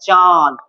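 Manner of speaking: চাঁদ drawn out with a nasal vowel. Shown as a demonstration. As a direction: demonstrate চাঁদ slowly, air passing through nose and mouth. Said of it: "আমরা যখন চাঁদ উচ্চারণ করবো". (চাঁদ নাক দিয়ে টেনে উচ্চারণ)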